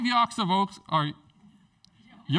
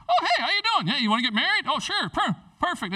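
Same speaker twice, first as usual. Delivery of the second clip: high, bright, breezy chatter; a hammed-up carefree wooer, pitched well up; mock casual proposal; 10 semitones higher, 4 dB louder than usual. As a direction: play it absurdly casual and high. Mock sudden proposal scene.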